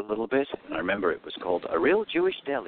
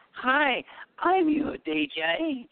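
put-on tiny high squeak of a nervous teenage DJ; mock-introduce yourself in a very high, slowed little voice; timid young DJ; much slower than usual. Shy teen DJ greeting the bride's father, squeaky high. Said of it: "it didn't look good for the, the father of the bride. I go".

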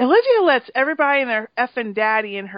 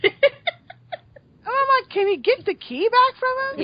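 laughing while asking; high, laughing exasperated plea; 13 semitones higher, slower than usual. Real, not acted.

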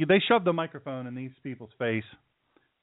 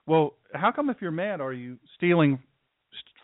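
usual speaking voice

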